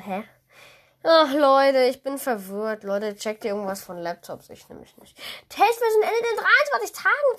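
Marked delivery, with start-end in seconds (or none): 5.5-7.4 high, stunned read-out; shocked. Real, not acted. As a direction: read it in disbelief. Reads message in shock. (fassungslos vorgelesen)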